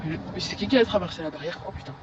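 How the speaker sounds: normal talking voice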